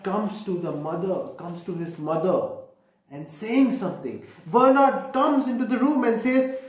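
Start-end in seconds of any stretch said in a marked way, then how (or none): none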